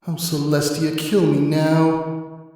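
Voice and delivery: slow, drawn-out groan of despair. Acted. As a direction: beg for mercy from the boring lesson. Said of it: "Scootaloo groaned".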